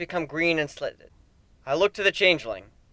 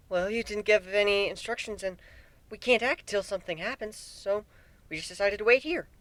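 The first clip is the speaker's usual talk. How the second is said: Twilight voice; 5 semitones higher, 7 dB quieter than usual. A narrator's reading. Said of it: "Twilight explained".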